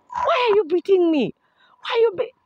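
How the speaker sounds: very high mock wail; wailed protest. Actor hit on the back by director, high wailing protest. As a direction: plead like a wronged victim, voice way up.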